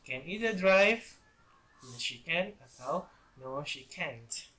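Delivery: ordinary delivery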